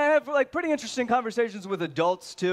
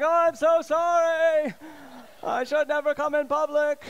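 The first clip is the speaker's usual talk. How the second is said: mock apology; 7 semitones higher than usual. Comedian mocking himself to the crowd, higher apology. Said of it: "I'm like".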